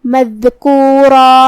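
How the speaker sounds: مذکورا chanted slowly, full heavy ra and long final vowel; heavy ra and alif. Shown as a demonstration. Teacher demonstrating the stop on ra, slow heavy-voiced chant. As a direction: stretch مذکورا, making the را thick and heavy. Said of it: "دونوں کو بھاری آواز میں پڑھیں".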